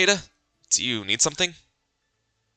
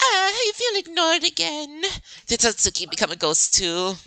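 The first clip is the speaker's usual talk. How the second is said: put-on high, bubbly teen voice; giddy girl character; 7 semitones higher than usual. Girl gushing to Kabazing about movie tickets, bubbly. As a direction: bounce along, eager and a bit whiny.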